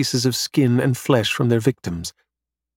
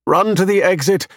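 high-pitched urgent yell; 8 semitones higher, 4 dB louder than usual. A narrator's reading. Robot sends two companions to the exit, yelling. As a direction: yell an order to flee. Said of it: "I yell at them".

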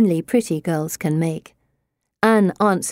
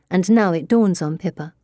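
usual speaking voice

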